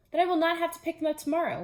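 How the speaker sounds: quick excited Toad; eager rush. Acted. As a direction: hurry through, excited.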